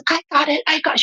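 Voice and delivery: put-on high, eager child voice; eager little girl. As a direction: pipe up like an excited little kid. Mother voices eager little daughter.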